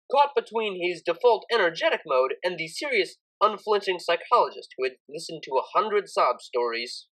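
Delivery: ordinary delivery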